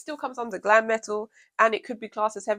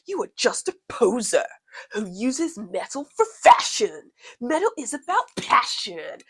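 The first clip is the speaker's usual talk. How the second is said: slow, drawn-out, louder mock of an angry metalhead; mocking troll voice; 4 semitones higher, 5 dB louder, slower than usual. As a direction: ham up the pompous troll. Reading troll's angry message mockingly.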